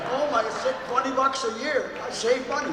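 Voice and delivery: high mock delight; gleeful, joking surprise; excited. Real, not acted. Delighted joke about dues.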